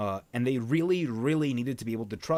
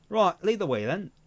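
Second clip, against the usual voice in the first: higher retake in an attempted Australian accent, drifting British; 7 semitones higher, 4 dB louder than usual. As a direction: try the line Australian again. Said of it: "still more British".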